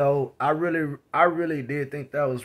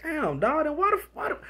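high, exasperated disbelief; why stay then, incredulous; 12 semitones higher, 3 dB quieter than usual. Retold or imitated. Narrator questioning a man who says he doesn't care, high pitched. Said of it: "I think that's what's like".

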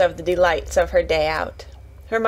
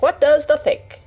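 higher, louder and grinning; cheerful grin; 7 semitones higher, 5 dB louder than usual. A narrator's reading. Martha about to reveal a present, cheerful grinning voice. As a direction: tease a surprise brightly. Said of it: "with a cheerful grin".

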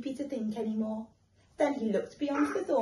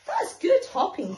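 higher, louder admiring; little hare in awe; impressed little hare; 9 semitones higher, 7 dB louder than usual. Acted. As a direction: gasp it in admiration.

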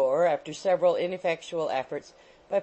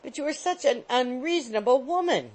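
mild protest, higher; remonstrating; 7 semitones higher than usual. A narrator's reading. Young man protesting to the angry landlady. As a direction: plead with her reasonably. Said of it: "remonstrated Mister Benjamin Allen".

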